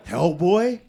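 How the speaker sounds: high, loud disbelieving Hellboy; light up with sudden recognition; surprised realisation. Retold or imitated. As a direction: staffer stunned it's him. Staff realising who it is.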